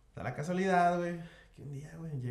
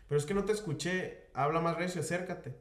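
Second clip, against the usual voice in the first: high, condescending order; mock haughty clerk telling him to speak up; rude clerk; 6 semitones higher than usual. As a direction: talk down to him through the glass. Rude clerk voiced in story.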